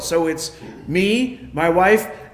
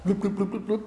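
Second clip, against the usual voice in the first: soft gurgling "bloop" noises; imitated pouring glugs; 2 semitones higher, 6 dB quieter, faster than usual. Man describes oil poured into his engine, imitated soft glugging. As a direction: mimic oil glugging from a bottle.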